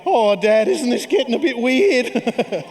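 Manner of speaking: put-on teen son's voice. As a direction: play the embarrassed teenage son, drawn out. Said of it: "just going".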